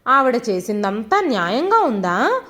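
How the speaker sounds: ordinary delivery, nothing marked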